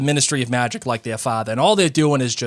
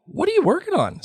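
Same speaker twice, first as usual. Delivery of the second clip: Harry asking. Acted. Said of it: "said Harry".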